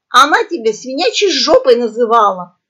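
loud, lively outcry; shouted tattle. A narrator's reading. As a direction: call it out to stir trouble. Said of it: "крикнула в паузе попугаиха". (громкий выкрик)

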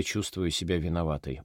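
ordinary delivery, nothing marked

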